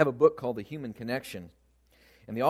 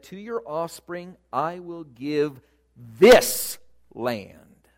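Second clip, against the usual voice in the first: this hit very loud, set apart before land; stress on THIS; 3 semitones higher, 9 dB louder, much slower than usual. Shown as a demonstration. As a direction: build slowly to a big, punched this. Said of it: "I think this is how God said it".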